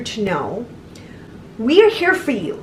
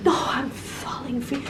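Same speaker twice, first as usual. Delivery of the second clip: mocking lovestruck voice; 2 semitones higher, 9 dB quieter than usual. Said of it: "That's what I would have been".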